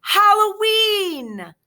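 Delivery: high, loud, slow drawn-out yell; shout. A narrator's reading. Boy shouting the holiday name to the class, excited. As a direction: stretch it out with glee. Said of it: "Thomas shouted".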